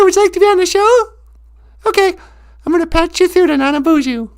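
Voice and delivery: chirpy receptionist patching the call; show assistant voice. Acted. Assistant patching Stallone through to the host, high put-on voice.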